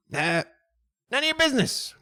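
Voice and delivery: high-pitched rat retort; high, dismissive rat; defiant rat. Acted. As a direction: refuse sharply in a high creature voice. Rat snaps back.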